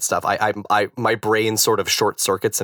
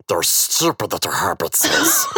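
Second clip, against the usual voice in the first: slow, lower creature voice from the film; Gollum; 4 semitones lower than usual. Shown as a demonstration. Guest shows friend his party trick, Gollum voice. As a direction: drag it out as a film-creature impersonation. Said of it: "I think I can do that voice".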